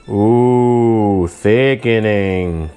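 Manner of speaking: slow drawn-out admiring thickening; sultry ooh. Real, not acted. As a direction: savour the word, low and slow.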